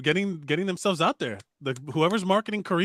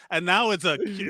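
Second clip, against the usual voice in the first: ordinary delivery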